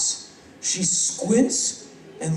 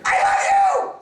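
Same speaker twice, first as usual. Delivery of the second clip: shrieking fan; 4 dB louder than usual. Retold or imitated. Starstruck woman to the man she recognized, shrieking. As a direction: scream adoration at the top of your range.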